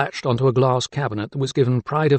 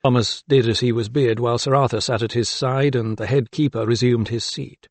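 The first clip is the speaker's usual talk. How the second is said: usual speaking voice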